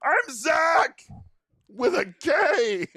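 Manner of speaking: high, loud, slow goofy voice; hammed-up robot clone shout; mock clone voice. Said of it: "they just come out and they're like".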